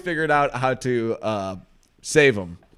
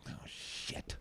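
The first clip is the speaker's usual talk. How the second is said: imitating a kid's whispered curse; whispered cuss; 21 dB quieter than usual. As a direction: swear under your breath, all whisper. Retold kid cursing while tying lure.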